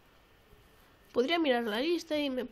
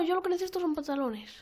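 usual speaking voice

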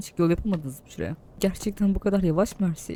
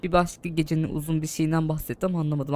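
normal talking voice

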